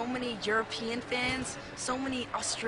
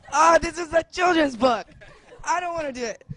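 laughing; 4 semitones higher, 5 dB louder, faster than usual. Real, not acted. Laughing at the children's book.

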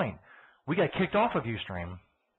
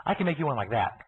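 ordinary talk, nothing marked

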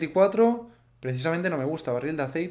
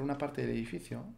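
ordinary delivery

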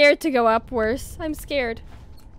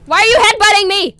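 high, loud panicked yell; startled shriek; 8 semitones higher, 12 dB louder than usual. Real, not acted. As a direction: yell at the enemy headbutting you. Enemy headbutting her in game.

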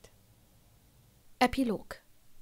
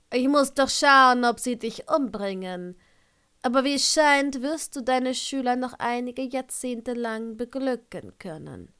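raised, drawling character voice, loud at the start; sardonic Severus; 2 semitones higher, 6 dB louder than usual. Acted. Narrator voices Severus teasing Minerva.

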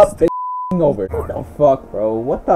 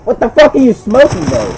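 loud, high yell of disbelief; furious shout with the word fuck hit hard; angry outburst; 6 semitones higher, 5 dB louder than usual. Real, not acted. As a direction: blast it at your teammate in disbelief. Yelling at teammate in game.

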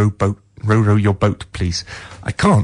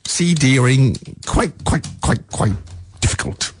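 voice giving out; 3 semitones higher than usual. Real, not acted. Radio host joking about the CD player as his voice gives out. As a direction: voice cracks and drops to a breathless near-whisper. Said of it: "My throat's all better now".

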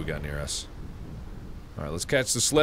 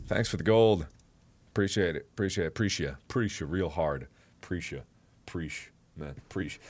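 softer and lower, fading to a murmur; sarcastic mumble; 7 semitones lower, 7 dB quieter than usual. Real, not acted. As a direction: trail off in dry irony. Mumbled ironic repetition.

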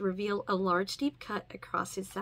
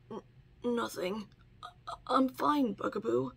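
weak, breathless. Acted. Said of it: "in a weak, breathless voice".

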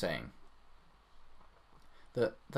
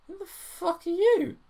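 high, slow, louder outraged yell; mock outrage; 7 dB louder, slower than usual. Gamer mock-yelling a dialogue line. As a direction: blast it like a furious girl.

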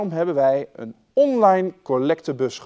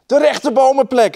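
high, loud excited cry; boy shouting; 12 semitones higher, 11 dB louder than usual. A narrator's reading. Jorkos spotting the tree place, loud high shout. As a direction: call out the discovery at the top of your voice. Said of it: "riep hij".

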